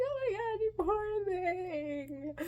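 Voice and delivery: very high, drawn-out pitying wail; overflowing sympathy. Retold or imitated. Tipsy stranger to kid with wrong food, overflowing sympathy. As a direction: gush pity, stretching it out. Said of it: "she's, like, dying of, like, sympathy".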